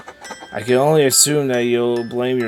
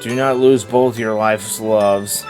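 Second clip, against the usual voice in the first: tender letter reading; 3 semitones lower, 6 dB quieter than usual. Acted. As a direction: read a dead wife's letter gently. Reads the dead wife's letter.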